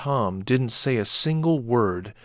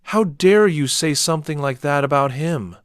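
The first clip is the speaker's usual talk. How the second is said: higher, louder outraged yell; father yelling at the neighbor, higher and louder; angry shout; 5 semitones higher, 6 dB louder than usual. A narrator's reading. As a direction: spit out the outrage.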